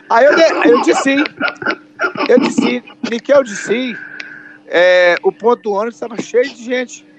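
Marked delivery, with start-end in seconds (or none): 0.0-1.1 laughing. Real, not acted. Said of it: "laughing at own story".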